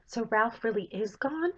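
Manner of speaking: lightly voiced young princess, worried.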